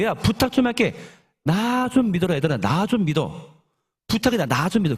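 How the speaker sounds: high-pitched, insistent acted begging; pleading teacher to stubborn listeners; exasperated plea. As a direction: implore them, at wit's end. Acted Jesus pleading to be believed.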